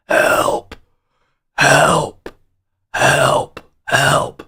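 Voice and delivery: faint, pitiful whispered calls; weak whispered help. Retold or imitated. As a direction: barely get the words out, sickly. Sick, calling weakly for help.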